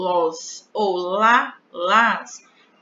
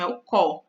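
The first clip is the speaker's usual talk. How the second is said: "có" spoken apart, open and stressed; stressed syllable co; 3 semitones lower than usual. Shown as a demonstration. Teacher to pupils, isolating the strong syllable "có". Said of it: "é a penúltima".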